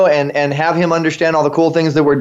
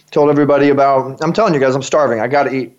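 normal talking voice

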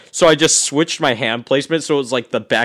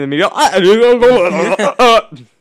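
mock profound; 5 semitones higher, 4 dB louder, much slower than usual. Mock wise answer, voice breaks.

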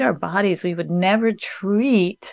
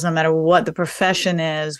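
ordinary talk, nothing marked

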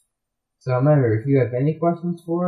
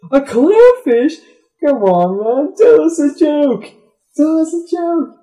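very high and loud, eager demand; excited heckling; 13 semitones higher, 7 dB louder, slower than usual. Retold or imitated. Sharks pestering the clownfish for a joke, high loud. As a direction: beg loudly for a joke.

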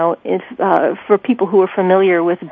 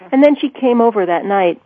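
usual speaking voice

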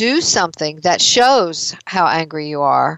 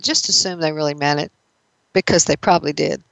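ordinary delivery

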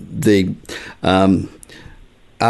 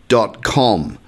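normal talking voice

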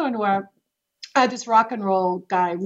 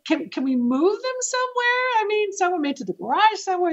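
alarmed plea; 11 semitones higher than usual. Retold or imitated. Pleading with snake owner.

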